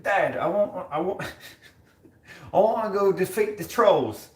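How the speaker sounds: high-pitched, eager put-on kid, cracking up; older kid voice. Son to dad about the trolls, high pleading kid voice. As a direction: pester dad in a high mock child voice. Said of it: "like, a little older. He's like".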